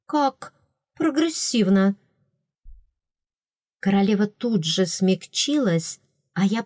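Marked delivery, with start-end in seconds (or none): 0.1-1.9 flattering. A narrator's reading. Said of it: "льстиво сказала".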